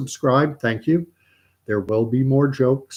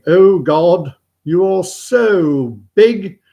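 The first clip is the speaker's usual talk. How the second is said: loud, slow, raised chapel voice; praying chaplain; 7 semitones higher, 10 dB louder, much slower than usual. Acted. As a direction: intone the prayer with drawn-out pomp. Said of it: "the vicar or priest or chaplain".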